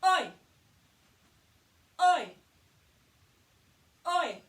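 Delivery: loud, high oi call; shouted oi. Shown as a demonstration. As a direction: bark oi across the street. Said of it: "And we do".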